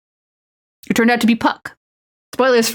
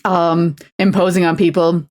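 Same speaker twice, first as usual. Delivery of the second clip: normal talking voice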